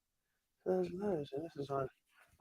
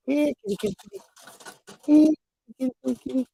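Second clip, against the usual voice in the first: calling the cat; 10 semitones higher, 6 dB louder, much faster than usual. Real, not acted.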